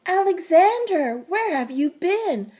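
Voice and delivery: high loud relieved call; crying out. A narrator's reading. Jessie finding her lost bear, loud and high. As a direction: call out to him, relieved and scolding. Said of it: "cried Jessie".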